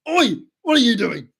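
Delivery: loud, high-pitched pirate voice; Jack Sparrow. Acted. Fan requested Jack voice, loud high pirate impersonation. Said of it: "All I can say in Jack's voice".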